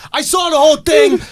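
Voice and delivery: loud, high imitation of an eager witness; passer-by calling out. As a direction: shout it as the eyewitness butting in. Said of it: "Guy walks up, he goes".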